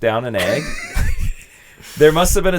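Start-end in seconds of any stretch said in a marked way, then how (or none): 0.7-1.3 squealing burst of laughter; high shrieking laugh. Real, not acted. Big laugh.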